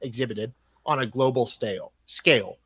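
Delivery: reading a letter out in a measured, formal tone; email read aloud. Acted.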